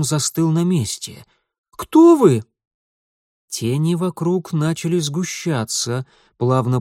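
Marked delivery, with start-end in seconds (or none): 1.7-2.4 high, loud terrified cry. Acted. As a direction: cry out in terror. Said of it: "охваченный страхом".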